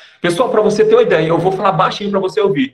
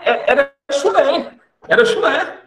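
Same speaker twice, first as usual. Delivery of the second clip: rindo; 6 semitones higher, slower than usual. Real, not acted. Rindo do salário antigo.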